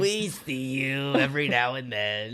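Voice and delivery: lightly voiced internet troll; creepy little online troll taunt. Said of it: "Is that your troll voice?".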